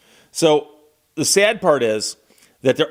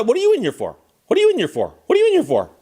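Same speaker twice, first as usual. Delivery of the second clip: higher, building to a shrill insistent peak; pestering cellmate; 9 semitones higher, much faster than usual. Retold or imitated. Snitch badgering the woman for a confession, pitch climbing. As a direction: nag harder each time she asks.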